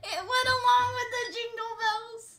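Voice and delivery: high-pitched through giggles; fighting back laughter; suppressed laughter. Real, not acted. Laugh and cry heard, high.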